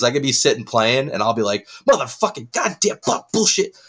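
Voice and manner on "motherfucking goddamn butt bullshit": high, strained mock cursing outburst; mock gamer rage. Gamer acting out getting mad at himself while playing, mock-furious. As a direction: imitate raging at the screen. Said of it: "I get really mad at myself".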